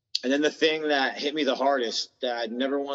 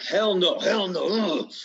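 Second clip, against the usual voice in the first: defiant hell no; 6 semitones higher than usual. Retold or imitated. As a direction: snap back hard against giving it up. Ego refusing to quit.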